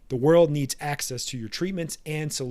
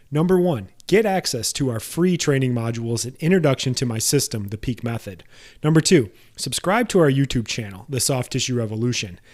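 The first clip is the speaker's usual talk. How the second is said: louder, upbeat promo read; 2 semitones lower, 6 dB louder than usual. Acted. Host reading the podcast outro ad to listeners, loud and promotional. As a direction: sell the free training like a radio spot. Closing ad read for his resources.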